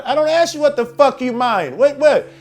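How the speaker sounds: high, loud indignant challenge; mad confrontation. Acted. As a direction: tell off an officer in an acted rant. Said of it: "I'm already mad".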